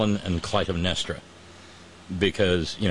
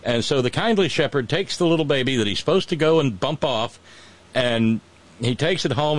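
usual speaking voice

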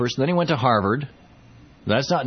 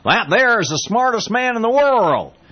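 higher, slow Texas twang; slow, high Texas drawl; folksy Bush drawl; 10 semitones higher, 3 dB louder than usual. Retold or imitated. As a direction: play it like a proud good ol' boy.

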